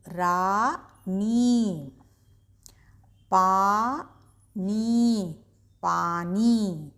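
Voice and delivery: slow, stretched blending of syllables; reading to children. Acted. Teacher guiding young readers through words, slowly. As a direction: sound out each piece, then join gently.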